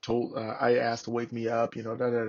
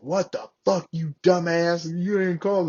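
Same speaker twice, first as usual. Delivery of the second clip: higher-pitched retold dressing-down; cussing out; 7 semitones higher, 3 dB louder than usual. Sergeant major cussing out a Marine for not calling, mock-angry. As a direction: imitate an irate sergeant major. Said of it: "cussing me out".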